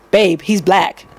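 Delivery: lightly voiced low-pitched man; imitating the boyfriend. Prisoner answering his girlfriend about the singer, lightly imitated. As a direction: drop the pitch for his reply.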